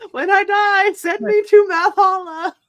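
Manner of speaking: high, loud hammed-up plea; mock dramatic plea. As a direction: declaim it like a grand last wish.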